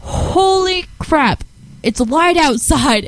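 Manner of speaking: slow, high, drawn-out amazement; surprised exclaim. Retold or imitated. Retelling walking out of the dark theater, slow surprised exclaim. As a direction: stretch it out in disbelief. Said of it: "and I go".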